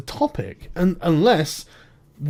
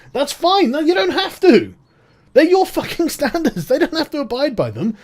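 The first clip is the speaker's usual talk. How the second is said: mocking exasperation; 8 semitones higher, 5 dB louder than usual. Real, not acted. Ranting, laughing at critics.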